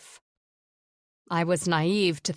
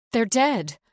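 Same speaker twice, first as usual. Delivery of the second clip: desperate; 5 semitones higher, 5 dB louder than usual. A narrator's reading. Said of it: "I say desperately".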